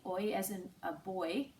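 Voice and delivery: normal talking voice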